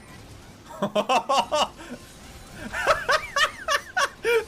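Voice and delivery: high, long burst of laughter; real laughter building into a peal; big laugh. Real, not acted. Streamer thrilled by a triple helm breaker, big laugh.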